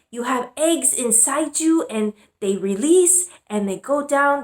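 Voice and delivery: imitating her mom. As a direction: fumble through the biology like the mom. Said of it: "And she's like".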